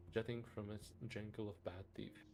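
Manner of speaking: normal talking voice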